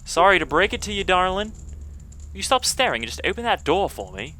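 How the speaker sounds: put-on high girlish English fairy; English accent. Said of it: "I wanna do the English accent".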